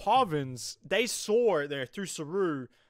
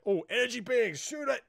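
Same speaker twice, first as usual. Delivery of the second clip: higher, hammed-up trigger-happy Klingon; mock Klingon; 8 semitones higher than usual. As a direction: toss it off like a brute who shoots first. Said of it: "they'll be like".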